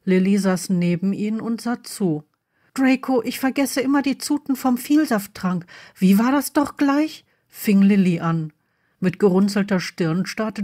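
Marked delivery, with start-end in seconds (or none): 2.7-7.2 put-on higher, innocent girl voice; higher-pitched, questioning young character; inquiring daughter. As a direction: ask as if puzzled, pitch up. Voices Lily asking innocently.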